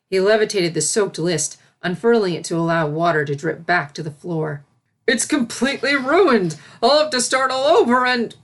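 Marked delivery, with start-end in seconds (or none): 5.1-8.4 high, loud flustered complaint; fretting servant. A narrator's reading. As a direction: panic over the ruined schedule. Servant dismayed about list.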